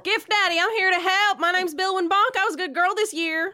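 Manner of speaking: high, excited shout; loud, high-pitched hero-worship; eager calling out. Acted. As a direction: call eagerly to someone behind a door. Player calls to captive through door.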